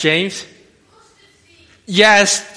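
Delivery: ordinary talk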